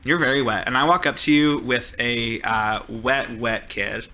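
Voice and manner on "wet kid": ordinary delivery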